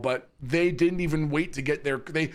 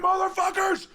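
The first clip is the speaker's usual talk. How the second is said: much higher, louder mock yell of a raving man; imitated screaming neighbor; 3 dB louder than usual. Streamer imitates the naked neighbor screaming in the yard, high hammed-up yell. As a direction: bellow it like a man howling in his yard. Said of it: "he's going".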